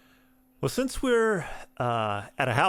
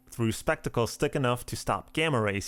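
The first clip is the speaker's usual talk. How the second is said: ordinary talk, nothing marked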